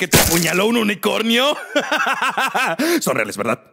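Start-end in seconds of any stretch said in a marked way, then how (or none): 1.6-3.0 short laugh, rising high; mocking laugh. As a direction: laugh at the client's story. Se burla del cliente. (risa burlona)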